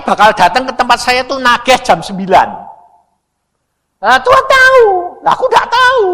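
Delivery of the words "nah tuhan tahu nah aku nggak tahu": very high, loud exclamation; squealed preaching emphasis; emphatic contrast. Real, not acted. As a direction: cry out both halves at full force. Emphatic contrast, God knows.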